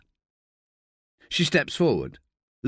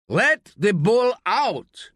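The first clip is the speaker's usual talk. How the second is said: high, slow, insistent shout; drawn-out commanding yell; 9 semitones higher, 3 dB louder, much slower than usual. A narrator's reading. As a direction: repeat the order, louder and more forceful. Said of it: "shouts Quesada".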